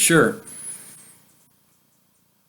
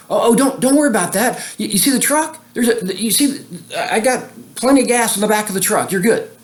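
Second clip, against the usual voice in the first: imitating an eager guy, pitched much higher; eager helpful stranger; 10 semitones higher than usual. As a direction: play the friendly guy rushing you along, voice up high. Guy reassuring about gas.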